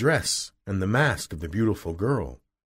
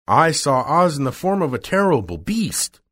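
raised, higher and louder exclamation; crying out; 7 semitones higher, 5 dB louder than usual. A narrator's reading. Woodman telling the others what he saw, crying out. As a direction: call it out with emphasis. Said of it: "cried the Woodman".

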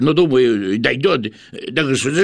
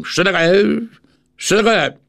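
high, quick, clipped questions; quick curt voice; 7 semitones higher than usual. Acted. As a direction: snap the question out fast. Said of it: "быстрым, отрывистым голосом".

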